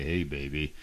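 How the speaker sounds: low, faked deep seducer. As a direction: put on an exaggerated deep, smooth come-on.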